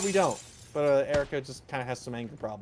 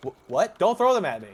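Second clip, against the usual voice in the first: retold angry boss; 7 semitones higher, 8 dB louder than usual. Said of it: "And he goes".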